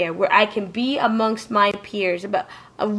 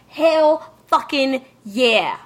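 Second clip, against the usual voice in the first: emphatic approval; 3 semitones higher, much slower than usual. Pretend. Acted reaction at imagined convention.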